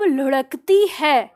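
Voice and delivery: लुढ़कती stretched very slowly; drawn-out word. Shown as a demonstration. Teacher stretching लुढ़कती to explain the name. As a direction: linger on लुढ़कती to show rolling. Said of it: "अंदर की तरफ".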